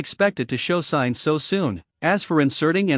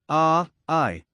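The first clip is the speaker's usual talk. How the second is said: ordinary delivery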